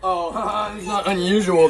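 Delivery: laughing mock of the critics; mocking imitation. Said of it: "That's what they'll say about me".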